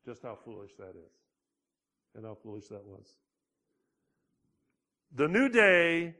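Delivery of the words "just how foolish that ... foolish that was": soft, fast, dropped-down aside; quiet aside. Real, not acted. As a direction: tuck it away under your breath. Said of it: "drops into a low aside".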